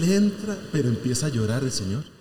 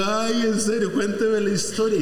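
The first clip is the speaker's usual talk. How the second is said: eager curiosity; 6 semitones higher than usual. Retold or imitated. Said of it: "Entonces yo le digo".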